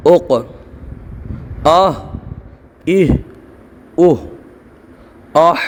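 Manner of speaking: each syllable "अत" ends in a bouncing echoed stop; slow, separate syllables with a rebound on the final consonant; qalqala drill. Shown as a demonstration. Quran teacher drilling students, bouncing echoed qalqala syllables. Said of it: "आवाज़ पलटेगी".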